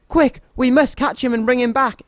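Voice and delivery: high, urgent call to give chase; rally the other to run after him; exclaimed. A narrator's reading. Goblin urges his partner to chase the donkey, urgent exclaim. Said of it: "exclaimed Hobble".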